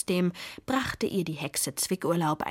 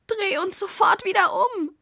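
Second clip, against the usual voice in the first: panicky demand; 12 semitones higher, 6 dB louder than usual. A narrator's reading. Upside-down witch demands to be righted.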